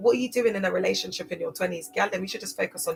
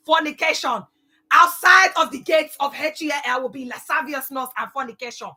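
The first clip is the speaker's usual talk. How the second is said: much higher, louder fire-and-brimstone rant; shouting preacher; 7 semitones higher, 8 dB louder than usual. Pretend. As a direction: preach it at full blast. Mock preaching Christianity at kids.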